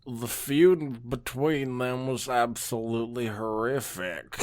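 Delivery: put-on slow, slurred tipsy old wizard; lightly drunk storyteller drawl; drunk Star Swirl. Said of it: "I'm trying to do a drunk Star Swirl".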